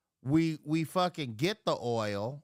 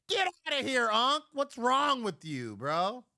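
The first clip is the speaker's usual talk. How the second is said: retold yell; 7 semitones higher than usual.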